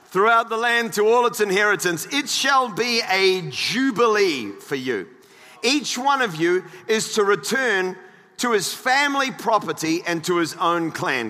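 emphatic, projected pulpit reading; measured Bible reading with weighted key words; scripture reading. Acted. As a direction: read the verses out to a congregation. Reads scripture aloud to congregation.

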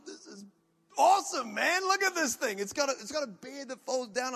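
high, loud, gushing wonder; a kid seeing the business class cabin for the first time; thrilled delight. Retold or imitated.

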